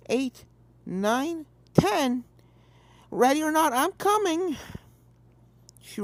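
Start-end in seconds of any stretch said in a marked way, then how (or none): 0.1-4.6 higher, singsong counting; playful filly counting. A narrator's reading. Apple Bloom finishing her count, playful and higher. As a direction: call out the count like a kid at play.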